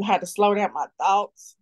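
usual speaking voice

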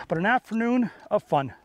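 ordinary talk

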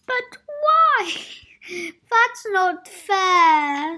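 high, loud, slow wail of protest; sobbing. Acted. Mister Adventurous protesting the cave closure, sobbing. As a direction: wail it like a child about to cry. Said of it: "sobbed Mister Adventurous".

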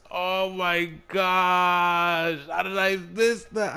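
high, loud, drawn-out frustrated wail; anguished yelling. Real, not acted. Gamer after blowing the lead, loud anguished wailing. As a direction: stretch the words out in pained disbelief.

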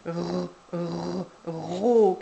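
"R, r" as held French R sounds, then a long loud "ro"; sounded-out consonant. Shown as a demonstration. Showing the first consonant, "R, r, ro" sounded out. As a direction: sound out "R" twice, then blend into stretched "ro". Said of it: "on commence par la consonne".

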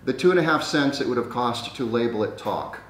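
ordinary delivery

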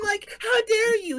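high, slow mock indignation; mock outrage. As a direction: act scandalized, hammed up.

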